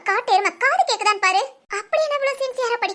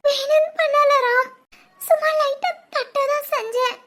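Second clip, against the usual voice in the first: crying apology; 2 semitones higher, 3 dB louder than usual. Acted. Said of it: "அழுகாத, முத அழுகை நிப்பாட்டு". (அழுதுகொண்டே மன்னிப்பு கேட்பது)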